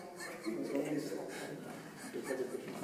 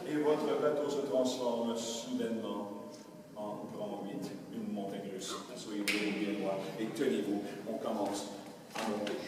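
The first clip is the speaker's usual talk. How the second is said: hypnotic induction; 4 semitones lower than usual. Acted. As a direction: draw them deep into the trance.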